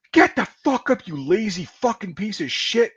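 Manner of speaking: furious mock yell, pitched well up; angry retold bark. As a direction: act out an angry superior rousting a slacker. Kicking a sick soldier, retold.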